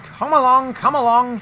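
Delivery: high, loud, effortful urging; puffing. A narrator's reading. James hauling reluctant trucks, puffing urgently. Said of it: "he puffed".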